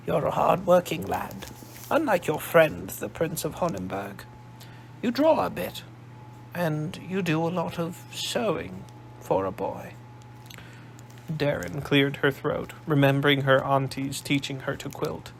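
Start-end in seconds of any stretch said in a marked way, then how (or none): 0.1-9.9 put-on a shrewd old matriarch, sizing him up; old grandmother. Old woman character.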